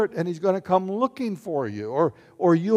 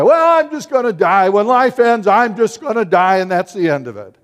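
mock careless quote; 4 semitones higher, 9 dB louder than usual. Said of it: "Here's what people say".

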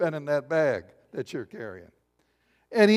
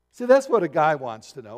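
ordinary talk

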